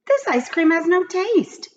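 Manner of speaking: lightly voiced upset little girl; whiny child complaint; plaintive girl. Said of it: "said Mauricia".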